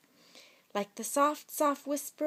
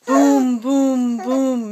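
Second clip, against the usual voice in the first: loud booming thunder; thunder booms; 8 dB louder, much slower than usual. Shown as a demonstration. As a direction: boom out like thunder. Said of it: "Mister Brown makes thunder".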